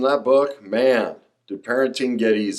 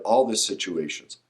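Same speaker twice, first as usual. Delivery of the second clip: ordinary talk, nothing marked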